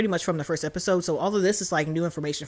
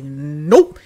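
a short, loud "Nope"; loud mock refusal; 3 semitones lower, 9 dB louder than usual. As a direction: slam the door with one loud word. Retelling Moon Knight stopping the monster.

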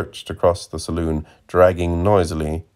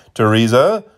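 called out higher and louder; cried out; 5 semitones higher, 6 dB louder than usual. A narrator's reading. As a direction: call her name across the bar. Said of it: "he cried".

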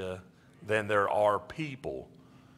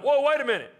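much higher and louder, a jolted stop; startled whoa; 7 dB louder, faster than usual. Retold or imitated. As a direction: throw up your hands in shock at the idea. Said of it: "they'd been like".

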